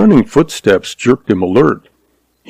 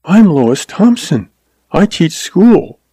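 put-on higher-pitched young woman's voice; earnest schoolmarm, pitched up; young schoolteacher; 8 semitones higher than usual. A narrator's reading. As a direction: introduce yourself in a high feminine voice. Young woman introduces herself.

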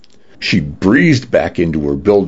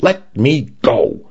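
slow, higher-pitched demand; mild shout; 6 semitones higher, slower than usual. A narrator's reading. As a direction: demand release word by word, without real volume. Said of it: "she shouted".

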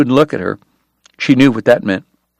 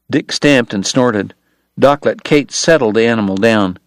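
plain reading voice

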